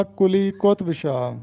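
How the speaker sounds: قطب in کلی قطب Shah said qotb, ط without a vowel; درست تلفظ. Shown as a demonstration. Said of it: "تو درست تلفظ ہوگا". (کلی قطب شاہ میں قُطْب، ط ساکن)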